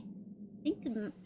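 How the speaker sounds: normal talking voice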